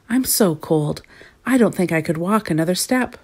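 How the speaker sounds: usual speaking voice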